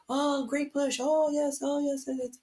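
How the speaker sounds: high, sing-song mock cheering; mock cheerleader. Mimicking the birth team cheering her pushes, high sing-song. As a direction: parody the team's eager praise.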